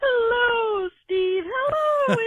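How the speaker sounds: high singsong hello; sing-song greeting. Real, not acted. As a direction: call out hello in a high lilting voice. Caller greets hosts in sing-song.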